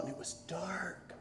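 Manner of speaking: breathy whisper of darkness; whispered spooky line. A narrator's reading. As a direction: hush it like a ghost story.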